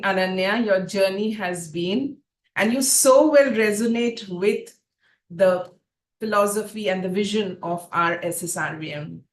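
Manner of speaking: ordinary delivery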